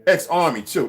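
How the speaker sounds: ordinary delivery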